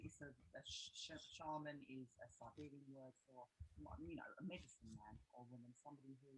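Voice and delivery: faint speech behind mic hiss; barely audible through a weak mic; low gain hiss. Real, not acted. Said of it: "you're really quiet".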